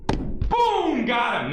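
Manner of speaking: loud, high gloating shout; triumphant. Real, not acted. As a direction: celebrate the comeback loudly. Teacher scores a comeback on student.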